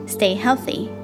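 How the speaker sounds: ordinary talk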